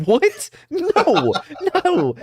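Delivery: high-pitched, incredulous denial through laughter; laughing disbelief. Retold or imitated. Retelling his denial to the cashier who assumed he was Mexican, laughing disbelief. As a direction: protest shrilly while cracking up.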